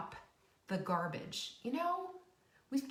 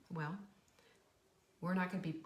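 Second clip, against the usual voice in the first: ordinary talk, nothing marked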